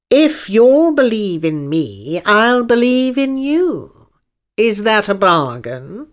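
slow, emphatic unicorn making an offer; drawn-out, grand unicorn voice; unicorn striking a bargain. Acted. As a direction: propose a deal in measured, lofty phrases.

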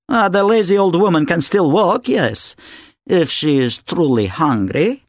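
mock the old woman; mocking wife. A narrator's reading. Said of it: "Natasha mocks the granny".

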